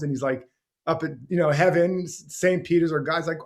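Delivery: ordinary talk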